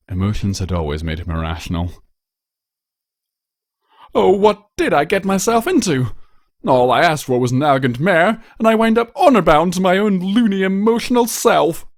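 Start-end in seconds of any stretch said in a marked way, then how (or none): 4.1-11.8 high, louder whiny lament of a pampered prince; self-pitying noble groan; exasperated prince. Acted. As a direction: bemoan your own foolish heart. Blue Blood laments his situation.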